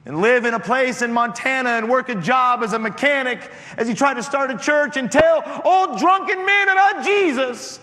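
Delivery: high, loud preacher's shout, building with each line; rally the young people at the altar call, full voice; preaching at full force. Acted. Preaching climax to congregation.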